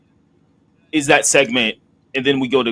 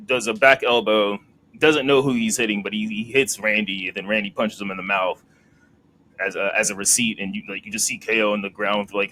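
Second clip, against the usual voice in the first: usual speaking voice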